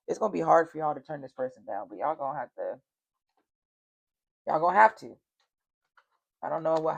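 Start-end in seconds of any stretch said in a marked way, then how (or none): 4.5-5.2 louder, hammering the point home; firm loud insistence. Real, not acted. Telling the water signs they must refuse him, loud and firm. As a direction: say it like a final warning.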